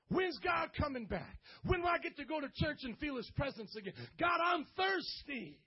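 high, loud cry out to God; urgent preacher's wail of yearning; impatient longing. Acted. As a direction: plead at full voice, slowing into a shout.

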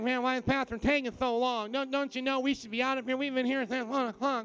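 high, hurried mock complaint; griping churchgoer. Churchgoer grumbles about the pastor taking so long, mock complaining. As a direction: whine like an impatient congregant. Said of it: "you become like this".

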